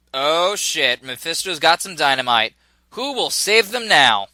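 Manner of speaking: ordinary talk, nothing marked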